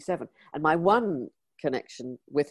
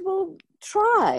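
encouraging push; 5 dB louder than usual. Retold or imitated. Elton urging her to write.

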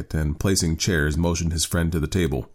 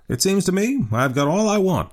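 put-on high-pitched, musing old father; slow old man; 14 semitones higher than usual. A narrator's reading. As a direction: muse aloud in a raised old man's voice. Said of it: "he said slowly".

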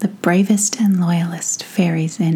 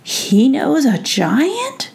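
slow, higher, wondering question; gently amazed royal voice; surprised prince; 7 semitones higher, much slower than usual. Acted.

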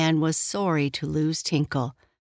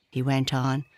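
plain reading voice

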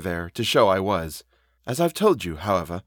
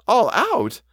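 high-pitched startled question; astonished echo; 13 semitones higher, 4 dB louder than usual. A narrator's reading. Housekeeper echoes the governess's news, high and astonished. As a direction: throw the words back up high in disbelief.

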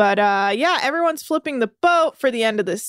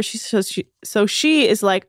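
ordinary delivery

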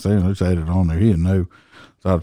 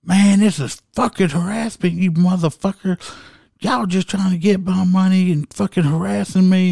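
screaming driver; 12 semitones higher than usual. Retold or imitated. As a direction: squeal it like an angry driver yelling from his car. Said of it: "screaming out his window".

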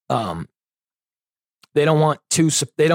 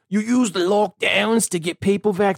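put-on higher, slow, botched Fauci accent; terrible put-on accent; 7 semitones higher than usual. Host quoting Fauci on lockdowns, in a terrible accent. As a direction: drag it out in a hammed-up bad accent. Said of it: "That's the worst accent I've ever seen".

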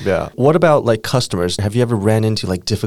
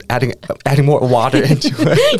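laughing; 6 semitones higher, 3 dB louder than usual. Real, not acted. Host laughs telling ramen joke.

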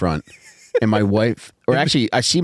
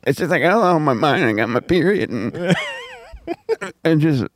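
lightly raised, hammed-up contrite wife; mock apologetic wife; 5 semitones higher than usual. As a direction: overplay a wife begging forgiveness. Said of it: "Your wife almost sounded like Peter Griffin".

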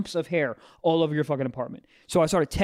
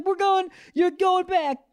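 very high, stretched-out singsong; high, slow baby-talk to a dog; mock cheerful owner; 14 semitones higher, slower than usual. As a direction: break bad news to a puppy sweetly. Owner answering puppy in high voice.

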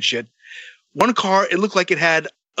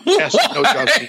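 laughing; 10 semitones higher than usual. Real, not acted. Laughing while retelling his answer.

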